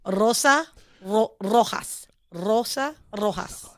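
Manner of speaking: slow Spanish demo, word by word; Spanish pronunciation. Shown as a demonstration. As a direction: model the words in Spanish. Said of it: "that was not in English".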